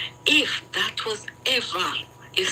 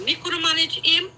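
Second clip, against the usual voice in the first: high, insistent repetition; raised emphatic voice; 9 semitones higher than usual. Real, not acted. Insisting to her friend she couldn't fight him, raised. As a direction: drive the point home up high.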